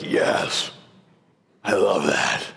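low, drawn-down relish; mock savouring. Father imagining his daughter loving meat and potatoes, hammed-up relish. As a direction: play up deep delight in the food.

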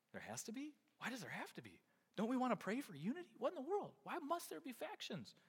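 soft, puzzled questions rising high; confused questioning. Retold or imitated. Imitating a listener puzzled by Paul's words, softly confused. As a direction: voice the congregation's bewildered objection. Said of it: "that almost sounds confusing".

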